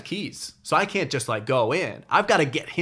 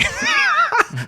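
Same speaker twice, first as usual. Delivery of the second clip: loud high burst of laughter; loud high laughing shout; big laugh; 7 dB louder than usual. Real, not acted. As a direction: cackle out loud at the memory. Big laugh after quote.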